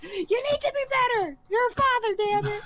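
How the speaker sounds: wife ranting at Sam about fatherhood, mock fury. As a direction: yell it like a fed-up wife. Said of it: "I'm like".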